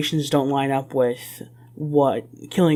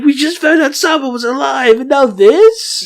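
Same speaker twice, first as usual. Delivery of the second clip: high, loud mock distress, stretched at the end; mock bawling; 9 semitones higher, 7 dB louder than usual. Imitating the bandits' reaction to Sabo news, mock bawling. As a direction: wail it in exaggerated dismay. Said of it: "like, bawling".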